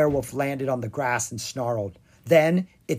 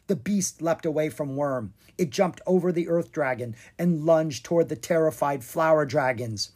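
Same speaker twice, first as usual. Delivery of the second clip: usual speaking voice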